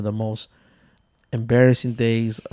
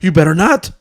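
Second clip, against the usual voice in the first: loud, high-pitched mock threat; angry dad; 5 semitones higher, 7 dB louder than usual. Dad threatens to burn the house down, angry and loud. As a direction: imitate a dad threatening his son.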